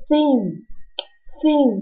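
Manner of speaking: "thin" said slowly, tongue between the teeth; slow, careful "thin" with the tongue out; slow th demo. Shown as a demonstration. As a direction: stretch out the th in "thin". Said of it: "把你的舌头放在你的牙齿中间".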